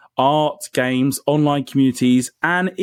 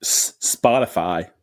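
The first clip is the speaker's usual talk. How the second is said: slow, low American "Spotify"; drawn-out American accent on Spotify; mock American accent; 7 semitones lower, slower than usual. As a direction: imitate an American saying Spotify. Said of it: "It was so ... American".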